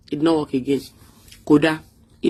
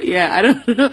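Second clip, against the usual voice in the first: laughing; 4 semitones higher, 5 dB louder than usual. Real, not acted. Laughs after the imitation.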